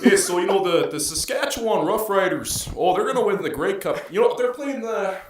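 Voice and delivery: put-on high, loud Canadian hockey-fan accent. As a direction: gush about football in a hammed-up Canadian accent. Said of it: "let's start with Canada".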